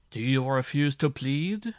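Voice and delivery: controlled menace, spoken slowly and firmly; ominous calm. A narrator's reading. Judge asks the accused to plead, ominous calm. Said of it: "in a voice of ominous calm".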